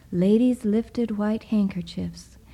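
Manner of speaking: plain reading voice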